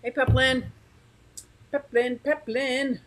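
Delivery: high, sing-song hello; cheery greeting. Real, not acted. Vlogger happily greets a viewer in the chat. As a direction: call out brightly to a friend.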